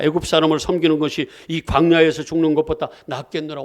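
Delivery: loud, ringing pulpit reading of the verses; forceful scripture reading. Acted. Preacher reading Exodus aloud to the congregation, loud and ringing. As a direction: proclaim the Israelites' complaint to the whole hall.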